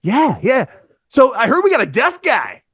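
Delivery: high, loud overeager voice; the newcomer telling Cody he's fired up, in a high, loud, eager voice; excited Jeff. Retold or imitated. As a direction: play him as a pumped-up rookie. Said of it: "And he just goes".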